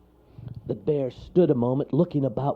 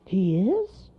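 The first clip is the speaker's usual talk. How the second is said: high, rising disbelief; squeaky amazed character; surprised cry; 9 semitones higher, 3 dB louder than usual. A narrator's reading. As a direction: ask it in happy surprise. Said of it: "cried Monroe".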